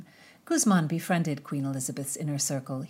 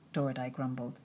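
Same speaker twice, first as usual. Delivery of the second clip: ordinary delivery, nothing marked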